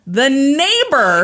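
high, loud, slow build-up; triumphant reveal. Real, not acted. Podcaster revealing the eyewitness to cheering co-host, high triumphant delivery. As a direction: stretch it out in excited triumph.

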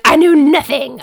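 loud, emphatic self-mockery; exclaiming. Shown as a demonstration. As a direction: declare it with a groan of embarrassment. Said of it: "I'm like".

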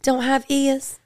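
high, slow, drawn-out grandma voice; imitating grandma. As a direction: play a scandalized grandma declaring. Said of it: "She'd be like".

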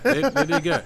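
they did good, way up high; squeaky mock reply; high mock answer. Repeats with big pitch jump.

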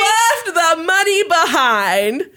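drawn out, high and slow; slow incredulous repeat. Real, not acted. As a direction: savour each word in disbelief. Repeats punchline for effect.

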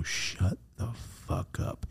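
slow, hushed, breathy astonishment; low, drawn-out mock shock; stunned disbelief. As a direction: whisper it slowly, scandalized.

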